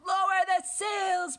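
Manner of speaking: long, high, loud storm order; shouting. A narrator's reading. Captain orders crew in storm, shouting.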